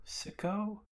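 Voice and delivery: mock-baffled repeat of sicko; incredulous echo. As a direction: echo the word as if it can't be true. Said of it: "And Sanji's like".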